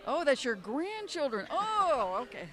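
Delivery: mock delighted surprise. Old friend at small con reacting to photos, mock surprised. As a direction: act charmed by the grandkids' pictures.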